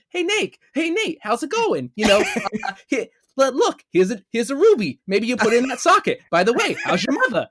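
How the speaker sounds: mock Sully voice, high and loud; mocking Wahlberg's Sully. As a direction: ham up a dopey buddy voice offering a ruby. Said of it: "he's just gonna be like".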